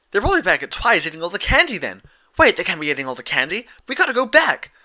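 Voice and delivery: fast, high, loud panic about candy; frantic Pinkie. Acted. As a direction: blurt it out in a rush. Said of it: "Pinkie cried".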